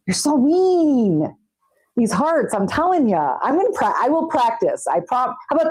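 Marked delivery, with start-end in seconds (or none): 0.1-1.3 drawn-out, higher playful pout; slow mock-offended whine; mock-hurt whine. Real, not acted. Repeats the line, drawn out, higher.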